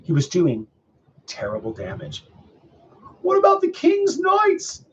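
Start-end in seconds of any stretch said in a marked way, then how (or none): 3.2-4.8 very high, loud, slow cry; high-pitched public outcry; clamouring villagers. A narrator's reading. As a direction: call out as anxious townsfolk. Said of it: "people began to say".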